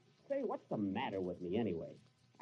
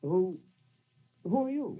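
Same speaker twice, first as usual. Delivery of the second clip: far higher, louder stammered question; startled; 8 semitones higher, 6 dB louder than usual. Acted. Benny startled by another ghost in the room, stammering fright.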